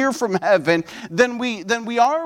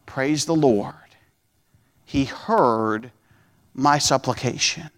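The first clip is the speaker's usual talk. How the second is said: hushed reverent praise; 7 semitones lower than usual. Acted. Praise inside retold prayer.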